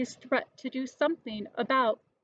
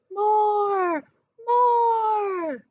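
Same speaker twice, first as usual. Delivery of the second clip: high, loud chant of More; crowd shouting; 12 semitones higher, 7 dB louder than usual. A narrator's reading. Shoppers shouting for more, loud high chant. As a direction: roar it out like a crowd.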